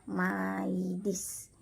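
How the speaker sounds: "maidis" slowly, ma-i-dis; Y read as I. Shown as a demonstration. Teacher demonstrating the rule, "maidis" in slow syllables. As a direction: stretch "maidis" with a separate i vowel. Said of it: "dibaca I".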